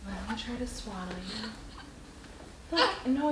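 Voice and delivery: very soft, slow coaxing; soothing. Real, not acted. Mother swaddling fussy baby.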